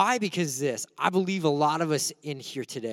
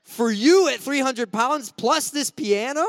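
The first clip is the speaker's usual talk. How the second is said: higher, louder disbelieving question; incredulous protest; 7 semitones higher, 4 dB louder than usual. Retold or imitated. Scrawny youth to big pastor, higher incredulous protest. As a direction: balk at the absurd request. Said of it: "and I'm like".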